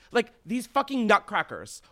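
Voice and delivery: ordinary talk, nothing marked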